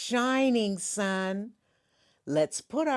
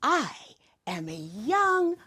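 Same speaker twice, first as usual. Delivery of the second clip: kindergarten teacher voice; 4 semitones higher, 5 dB louder than usual. Acted. Host leads pledge slowly.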